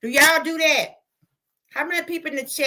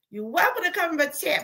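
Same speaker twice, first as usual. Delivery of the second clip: ordinary talk, nothing marked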